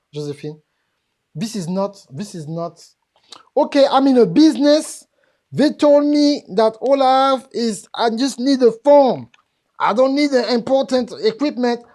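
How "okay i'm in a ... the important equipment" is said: loud, high-pitched mimicry of a business owner; mock naive boasting. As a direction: belt it out like an overconfident beginner.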